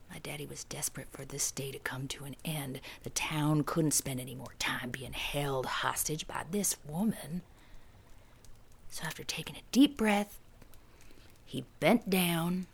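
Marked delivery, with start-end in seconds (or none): 0.1-7.4 hushed whisper. A narrator's reading. Hushed narration.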